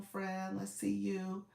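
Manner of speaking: normal talking voice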